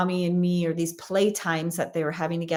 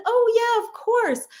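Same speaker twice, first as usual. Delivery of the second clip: very high-pitched, sweet agreeable voice; sweet librarian impression; 14 semitones higher, 3 dB louder than usual. Librarian reassuring a mother that parents can come, sweetly high-pitched. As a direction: imitate an obliging librarian, pitched way up. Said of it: "this woman is so sweet".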